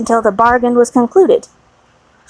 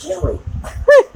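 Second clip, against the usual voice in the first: laughing; 9 semitones higher than usual. Real, not acted. Reader breaks up laughing.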